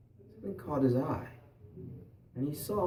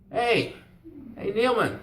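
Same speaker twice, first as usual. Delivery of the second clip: loud, raised hailing call; 7 semitones higher, 6 dB louder, slower than usual. Acted. Grandfather hailing the fisherman by the river, calling out. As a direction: hail someone across the riverbank.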